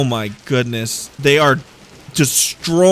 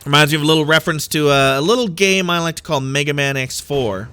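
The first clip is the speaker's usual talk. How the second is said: ordinary talk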